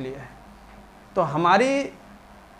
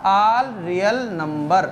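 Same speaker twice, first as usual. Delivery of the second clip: slow recital; 4 semitones lower, much slower than usual. Shown as a demonstration. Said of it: "domain है वो क्या है".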